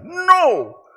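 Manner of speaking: high, loud cry of rebuke; ringing pulpit shout; sharp shouted no. Real, not acted. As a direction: cut them off with a shout. Preacher's sudden rebuke.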